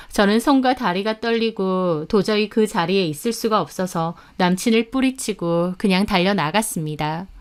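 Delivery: ordinary delivery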